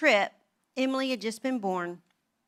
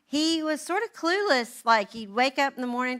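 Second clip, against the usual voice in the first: normal talking voice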